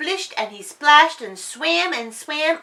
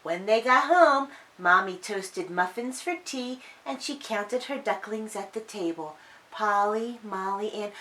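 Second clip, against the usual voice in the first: ordinary delivery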